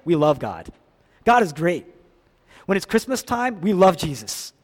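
ordinary talk, nothing marked

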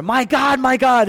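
high, loud anguished call; emotional cry. Acted. Jesus on the cross calling to God, emotional cry. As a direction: cry out with raw feeling. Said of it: "There's emotional language there".

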